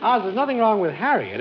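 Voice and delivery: ordinary talk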